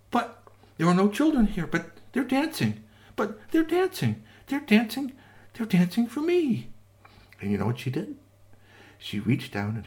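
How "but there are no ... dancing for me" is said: much higher, wondering voice; amazed delight. Acted. Witch amazed the dolls dance for her, high and delighted. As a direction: marvel at it, rising with joy.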